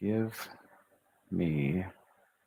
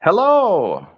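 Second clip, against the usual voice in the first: bright Hello much higher and louder, falling off; energetic hello; 10 semitones higher, 9 dB louder than usual. Shown as a demonstration. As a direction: greet with full energy. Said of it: "Exclamation mark".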